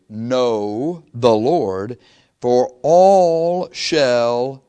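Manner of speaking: very slow, drawn-out know the Lord; stressed knowing. Shown as a demonstration. Preacher stressing the word know, drawn out slowly. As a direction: stretch every word to point at KNOW. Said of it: "what are the next four letters".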